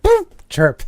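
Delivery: high, loud tire chirp noise. Retold or imitated. Mimicking his car chirping as he speeds off, high and loud. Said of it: "like, a little, like".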